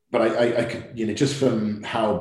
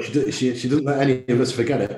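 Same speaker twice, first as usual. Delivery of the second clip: ordinary delivery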